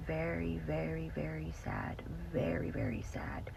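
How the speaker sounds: low, hushed grief; quiet mourning; sad whisper. Real, not acted. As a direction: let the sadness fall into a whisper. Grieving over the story.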